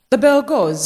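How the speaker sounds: ordinary delivery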